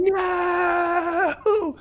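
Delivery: high hammed-up "No!"; mock movie scream. Imitating the slow-motion crash victim, high hammed-up "No!". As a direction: wail it like the doomed driver in the movie. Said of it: "with the guy going".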